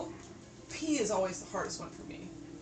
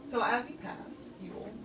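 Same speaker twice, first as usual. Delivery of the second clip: ominous narration; 9 dB quieter than usual. Acted. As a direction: build dread over the cages. Game master describes the cages.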